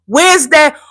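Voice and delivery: usual speaking voice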